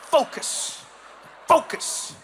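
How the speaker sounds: sharp, repeated one-word command; mock serious. Dutch cyclist riding straight-backed, mock-serious intensity. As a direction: bite out each word with grim purpose. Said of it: "in such a serious way".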